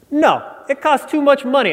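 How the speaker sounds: lightly mocking New York clerk brushing him off; the guy's accent. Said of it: "he actually had this accent".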